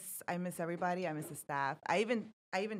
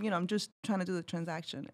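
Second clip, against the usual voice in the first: normal talking voice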